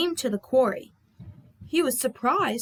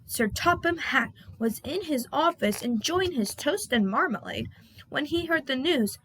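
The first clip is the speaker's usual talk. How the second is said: plain reading voice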